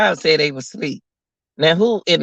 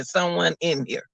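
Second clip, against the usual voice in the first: hushed quote; 7 dB quieter than usual. Retold or imitated. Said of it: "did you hear them say".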